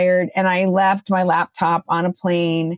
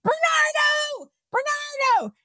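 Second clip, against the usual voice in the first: calling out; 5 dB quieter, slower than usual. Retold or imitated. Calling flight attendant for help.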